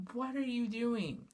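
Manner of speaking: normal talking voice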